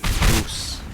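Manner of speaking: ordinary talk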